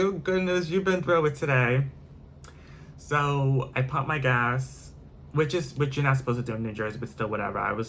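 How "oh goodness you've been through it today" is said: a hint of a higher, sympathetic attendant; slightly raised pity; lightly voiced attendant. Attendant to the frazzled driver, lightly mock sympathetic. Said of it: "He was like".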